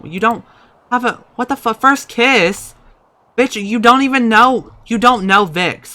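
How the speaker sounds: high and loud indignant rant; outraged disbelief. Real, not acted. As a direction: protest like a scandalized friend. Outraged at Vix's first kiss.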